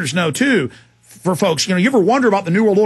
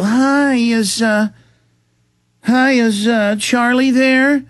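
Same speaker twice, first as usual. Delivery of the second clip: high, hesitant, slow fake-polite caller; mock caller voice; 5 semitones higher, much slower than usual. Imitating a caller asking the wife for Charlie, halting and high. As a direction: play the sneaky caller fishing for Charlie, halting. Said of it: "They'll call up and go".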